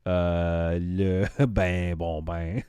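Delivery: slow, hammed-up French, drawn-out vowels; bad French accent. Streamer showing off French to a viewer, bad French accent. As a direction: put on French, groping for words. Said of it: "my French accent was so bad".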